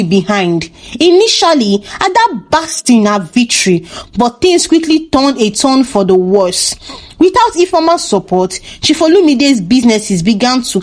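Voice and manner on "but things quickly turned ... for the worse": ordinary delivery, nothing marked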